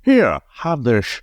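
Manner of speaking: put-on Sean Connery's slurred "sh" accent. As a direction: imitate Connery's accent, turning s into sh. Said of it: "I'm gonna do his accent".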